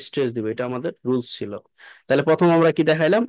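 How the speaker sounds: normal talking voice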